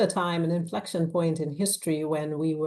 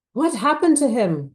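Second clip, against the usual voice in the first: loud, high, shocked demand; indignant shout; 8 semitones higher, 9 dB louder than usual. A narrator's reading. Lawyer confronting a police officer, shouted. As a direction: shout it out in alarm. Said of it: "Grace shouted at Officer Lungu".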